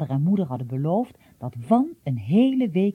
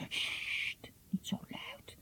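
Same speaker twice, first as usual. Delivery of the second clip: hushed, alarmed whisper; frightened whisper; 11 semitones higher, 19 dB quieter, much slower than usual. Acted. Ignilde hushing big Jiba in fright, whispering. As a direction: shush him urgently under your breath. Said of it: "fluisterde Ignilde verschrikt".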